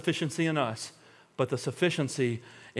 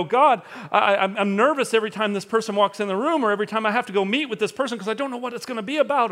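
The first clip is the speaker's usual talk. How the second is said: nervous prayer; 6 semitones higher, 3 dB louder, faster than usual. Retold or imitated. Anxious prayer voiced in story.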